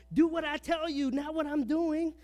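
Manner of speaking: high, loud mock parental command; bossy parent. Preacher mocking parents who don't practice it, high loud. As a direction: bark it out like a hypocritical parent.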